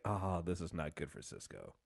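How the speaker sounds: low, quiet aside of foreboding; murmured uh-oh reaction; hushed dread. Retold or imitated. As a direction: drop low and soft, as if trouble is coming. Hushed reaction to looming trouble.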